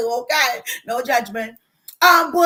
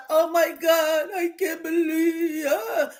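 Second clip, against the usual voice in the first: retold crying; slower than usual. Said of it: "you know how we cry".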